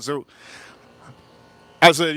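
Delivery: ordinary talk, nothing marked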